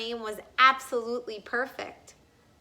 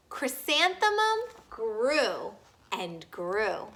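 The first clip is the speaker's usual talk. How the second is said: usual speaking voice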